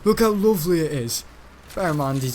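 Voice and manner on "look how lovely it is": put-on a light, raised take on the proud old granddad; imitating granddad. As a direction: show off the case like grandpa would. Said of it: "He was going on about it".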